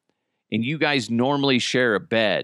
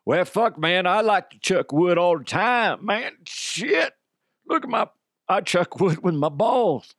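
goofy macho character; 6 semitones higher than usual. Acted. Doing a woodchucker character.

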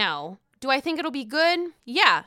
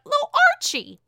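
cooing; 5 dB louder than usual. Real, not acted. Cooing about the dog.

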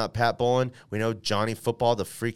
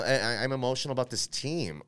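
normal talking voice

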